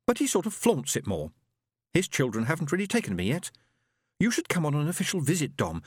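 put-on chatty young woman; sister's voice. Sister chatting about husband.

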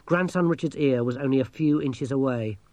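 usual speaking voice

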